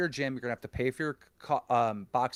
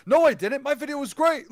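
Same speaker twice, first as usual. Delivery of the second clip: mocking imitation; 13 semitones higher, 7 dB louder than usual. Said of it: "He goes".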